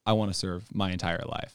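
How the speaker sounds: ordinary talk, nothing marked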